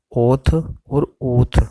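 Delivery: ओथ और ऊथ drawn out very slowly, each vowel held long; careful sound demo. Shown as a demonstration. Teacher sounding out shorthand readings for students, slow and deliberate. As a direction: spell out ओथ and ऊथ slowly, syllable by syllable. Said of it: "तो हो जाएगा आथ".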